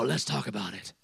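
a low, hushed coaxing friend, imitated; mocking friends. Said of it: "all my friends would come and go".

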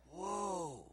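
low, soft, drawn-out awe; quietly awed. Retold or imitated. Speaker amazed by friend's insight, quietly awed. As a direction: let the amazement out softly and low. His amazed reaction, first take.

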